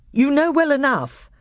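put-on high, loud young king. A narrator's reading. Caspian answering the dwarf in council, loud and firm. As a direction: stand firm on your word.

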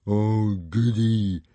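loud, gloating thug voice; oafish, pleased bully; happy brute. A narrator's reading. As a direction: relish it like a big bully. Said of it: "said Dog's Breath happily".

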